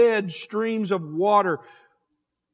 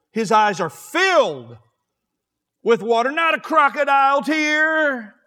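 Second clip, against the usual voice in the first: louder, rising preacher's cry about tears; impassioned preaching; 3 semitones higher, 5 dB louder than usual. Acted. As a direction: build the fire toward streams of water. Preaching holy tears, building loud.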